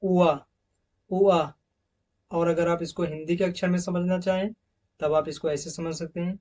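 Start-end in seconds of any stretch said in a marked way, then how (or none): 0.0-1.4 उआ sounded out, louder and stretched; teacher modelling the उआ sound; diphthong demo. Shown as a demonstration. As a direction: say उआ as a clear glide from short u to a. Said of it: "इस sound को दोस्तों देखिए".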